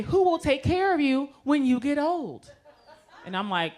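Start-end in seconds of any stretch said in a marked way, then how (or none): 0.0-2.5 high-pitched imitation of a patient; concerned patient. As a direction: ask it high, mock-concerned. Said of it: "They say".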